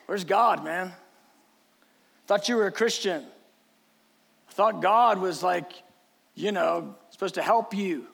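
low, casual needling voice; mock doubting friend. Job's friends questioning him as the house burns, low-key mocking. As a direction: needle a suffering friend offhandedly.

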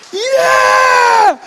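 slow, very high, full-force praise holler; exultant shout. Retold or imitated. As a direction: proclaim it screaming with joy. Shouting praise in parking lot.

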